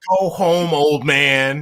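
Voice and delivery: mock taunt. Voicing taunt at Messi.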